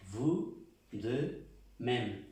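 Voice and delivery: "vous de même" very slow and drawn out; slow French phrase. Shown as a demonstration. Teacher repeating "vous de même" slowly for students. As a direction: model "vous de même" syllable by syllable.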